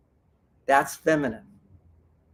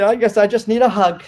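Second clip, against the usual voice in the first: asking for a hug; 3 semitones higher, 6 dB louder than usual. Shown as a demonstration. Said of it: "Then you say".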